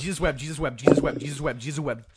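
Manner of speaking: a fumbled race through the repeats; rapid tongue twister. Shown as a demonstration. Friend dares him to say Jesus wept fast, rushed and slurred. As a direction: rattle off Jesus wept as fast as you can, words blurring. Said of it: "Try it".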